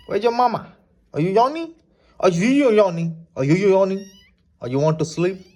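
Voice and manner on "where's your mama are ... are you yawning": high, loud sing-song calling to a pet; high pet talk. Acted. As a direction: coo up high at the little animal like a baby.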